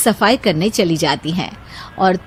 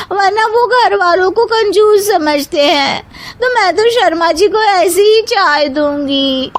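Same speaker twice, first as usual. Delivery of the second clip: put-on high, whining childish woman voice; whiny young bahu; 10 semitones higher than usual. A narrator's reading. Youngest bahu quotes her mother to the others, high whiny voice. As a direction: insist in a tearful whine.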